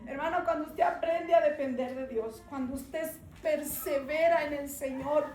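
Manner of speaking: preaching. Acted. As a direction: preach it to the brothers with rising force. Testimony rises into preaching.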